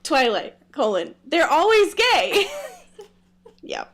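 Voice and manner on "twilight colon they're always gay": loud, stressed always; mock proclamation. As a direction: announce it like a title.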